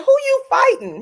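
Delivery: high, loud mock-startled demand; retold yelp of a bewildered husband; startled questioning. Husband woken at night questions his wife, startled.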